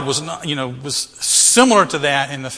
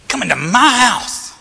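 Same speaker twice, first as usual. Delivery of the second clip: high, thrilled emphasis on "my"; excited Zacchaeus; 8 semitones higher, 3 dB louder than usual. Acted. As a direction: marvel at the honour, pitch leaping. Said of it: "How exciting that could be".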